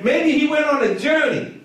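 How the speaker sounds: high mock-helpful suggestions, stretched at the end. Elijah offering excuses for their silent god, mocking. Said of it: "He started mocking them".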